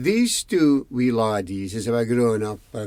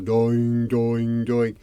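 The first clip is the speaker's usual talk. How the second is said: imitated bell tolls on one even note; bell ringing; much slower than usual. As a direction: toll it out like a bell. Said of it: "finally he rings the bell".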